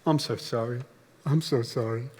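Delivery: low, hushed mock whisper of apology; pathetic whispering. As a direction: imitate a broken singer murmuring into the mic. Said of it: "just rather pathetically whispering into his microphone".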